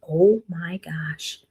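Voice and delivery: hushed, slow "oh my gosh" in awe; whispered realization. Pretend. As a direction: breathe it out as it dawns on her. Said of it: "she would be like".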